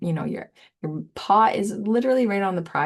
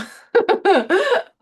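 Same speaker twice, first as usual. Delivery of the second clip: high laugh; 8 dB louder than usual. Real, not acted. Laugh burst.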